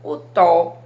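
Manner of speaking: loud, isolated "tó" in "o tó"; stressed syllable. Shown as a demonstration. Teacher pointing out the tonic syllable, "tó" said forcefully. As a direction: punch out "tó" on its own. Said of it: "é o que eu pronunciei com mais intensidade".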